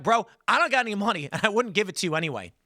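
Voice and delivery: brushing off a mugger with a laugh, laughing; laughing refusal. Retold or imitated. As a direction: laugh it off, amused, not scared. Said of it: "And I laughed".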